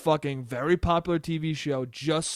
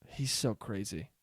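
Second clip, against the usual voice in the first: low, hushed aside; muttering dad impression; 7 semitones lower, 10 dB quieter than usual. Son imitating dad's reaction, low mutter. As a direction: drop it to a quiet mutter.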